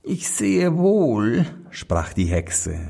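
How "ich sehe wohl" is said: hoch und langsam gedehnt; Hexenstimme. Acted. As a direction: lauernde alte Hexe. Hexe spricht langsam. (höhnisch gedehnte Hexe)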